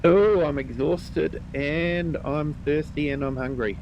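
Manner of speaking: usual speaking voice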